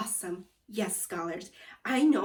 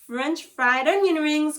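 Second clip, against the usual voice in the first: correcting; 5 semitones higher, 9 dB louder than usual. Acted. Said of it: "Gloria said".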